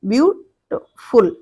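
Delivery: slow "beautiful" with gaps between syllables. Shown as a demonstration. Teacher repeating "beautiful" for syllable counting, slowly split. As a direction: break "beautiful" slowly into its sound chunks. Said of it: "ये तीन syllable से बना है".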